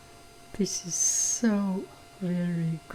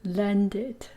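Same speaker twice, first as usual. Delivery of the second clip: ordinary delivery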